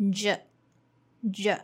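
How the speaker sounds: J, J as a voiced zh; zh sound. Shown as a demonstration. Teacher drilling zh with students. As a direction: buzz the J as zh twice. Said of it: "let's try it again".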